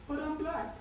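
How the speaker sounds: a hint of a shocked victim; robbed woman. Robbed woman protesting to the shop, mock high and soft. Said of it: "she's there saying".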